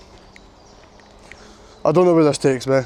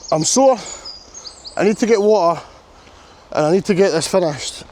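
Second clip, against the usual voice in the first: strained; 4 semitones higher than usual. Real, not acted. Pained, urgent exclamation.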